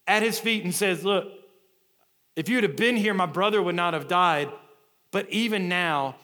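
loud, high, emphatic preacher delivery; full-force preaching. Real, not acted. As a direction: proclaim it to the whole congregation.

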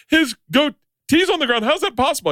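very high-pitched mock disbelief; shocked daughter. Daughter asks dad about the torn-off goatee, high mock shock. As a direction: squeal it like an astonished kid.

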